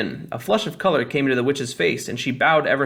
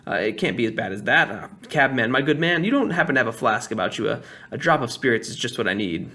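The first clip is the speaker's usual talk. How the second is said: ordinary delivery, nothing marked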